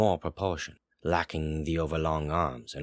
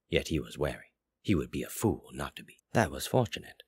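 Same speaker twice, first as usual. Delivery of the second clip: plain reading voice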